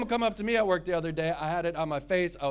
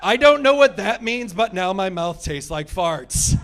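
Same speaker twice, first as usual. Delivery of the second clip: louder, slower disgusted outburst; comic outburst about kombucha; 2 semitones higher, 8 dB louder, slower than usual. Acted. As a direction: blurt out the disgust. Disgust rant.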